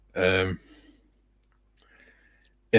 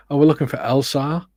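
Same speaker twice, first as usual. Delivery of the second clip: higher, eager parent asking for "Elsa"; imitating a parent; 6 semitones higher, 4 dB louder than usual. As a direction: play the parent saying "Elsa" with an r-sound. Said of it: "parents coming in going".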